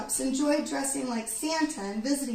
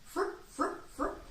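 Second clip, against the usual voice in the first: breathy high fluttering frip sounds; wing flaps; 6 semitones higher, 5 dB quieter than usual. A narrator's reading. Wings flapping sound.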